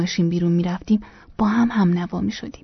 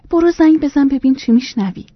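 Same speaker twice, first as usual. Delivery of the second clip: higher, louder vexed retort; exasperated; 5 semitones higher, 5 dB louder than usual. A narrator's reading. Wife refusing husband's consolation, exasperated. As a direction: throw it back in mild exasperation.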